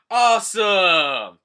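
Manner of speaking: loud, drawn-out cheer. Acted. Fishermen cheering Decken's power in awe.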